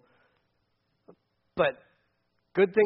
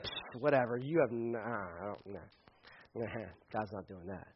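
much softer, brushing it off; dismissive; 9 semitones lower, 12 dB quieter, slower than usual. Retold or imitated. Habakkuk shrugging off God's plan, soft and dismissive. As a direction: scoff it away under your breath. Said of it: "you'd be like".

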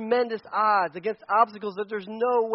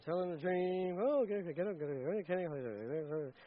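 soft half-voiced read-through; skimming under breath; 4 semitones lower, 14 dB quieter than usual. Real, not acted. Preacher hunting for his place in the Bible, reading under his breath. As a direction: skim the text quietly to yourself.

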